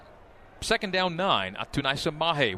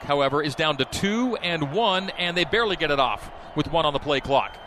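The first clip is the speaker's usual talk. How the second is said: ordinary talk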